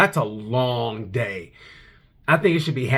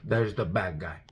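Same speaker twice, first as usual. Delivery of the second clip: Scarface; 5 semitones lower, 5 dB quieter than usual. Retold or imitated. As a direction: drop it soft and low like a crime boss. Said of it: "Marc Marquez is a Scarface of Moto G P".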